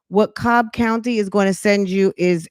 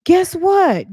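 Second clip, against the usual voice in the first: sassy taunt; 11 semitones higher than usual. Real, not acted. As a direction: guess what pitched high and teasing. Repeated taunt, higher.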